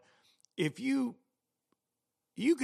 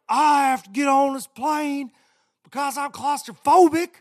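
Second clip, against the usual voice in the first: imitating a man screaming at a lady; retold screaming; 13 semitones higher, 13 dB louder than usual. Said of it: "he's, like, screaming at this lady".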